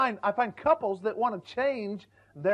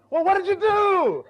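much higher and louder, pleading wife; a wife pressing her husband for details, much higher and louder; imitated wife; 10 semitones higher, 6 dB louder than usual. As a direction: beg for more detail like a frustrated wife. Wife voiced.

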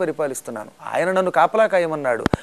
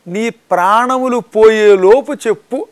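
loud, slow, threatening demand; loud slow menacing order; menacing threat; 5 semitones higher, 7 dB louder, slower than usual. Acted. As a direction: demand it slowly like a fierce guard. Demoness threatens Hanuman.

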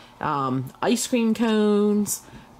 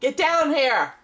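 raised voice calling upstairs; yelling across the house; 5 semitones higher, slower than usual. Real, not acted. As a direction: call up to him firmly. Calling son from downstairs.

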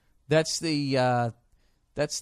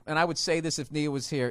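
normal talking voice

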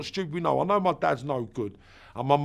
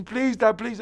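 pleading scream; 8 semitones higher than usual. Retold or imitated. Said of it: "Physically, I'm screaming".

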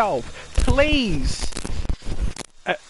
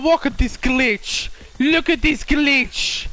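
ordinary talk, nothing marked

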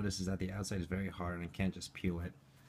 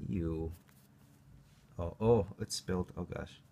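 usual speaking voice

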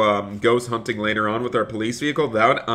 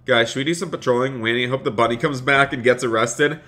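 ordinary delivery

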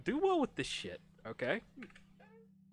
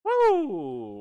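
loud happy whoop; excited cheer; 4 semitones higher, 10 dB louder, slower than usual. Real, not acted. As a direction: celebrate the checkpoint. Cheering at the first checkpoint.

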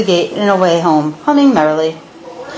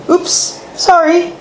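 high, drawn-out flustered apology; sheepish, pitched-up storybook apology; blustering; 9 semitones higher, slower than usual. A narrator's reading. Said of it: "blustered Neil".

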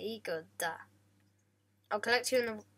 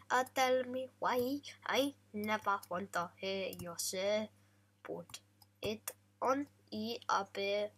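lyric recited in a light mock Italian accent, unsung; mock Italian accent, spoken. Gamer reciting the lyric to his cow, lightly mock Italian, spoken. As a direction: talk the words in a hint of Italian accent.

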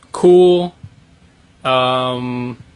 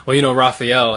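raised voice to be heard; loud, not quite yelling; speaking up; 2 semitones higher, 9 dB louder than usual. Real, not acted. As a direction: project louder so viewers can hear. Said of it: "I'm gonna have to start yelling now".

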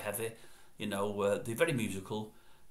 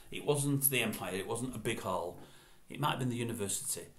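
ordinary delivery

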